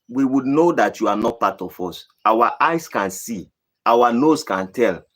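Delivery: ordinary talk, nothing marked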